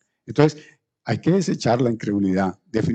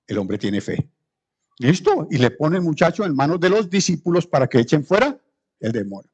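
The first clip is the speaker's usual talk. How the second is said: ordinary talk, nothing marked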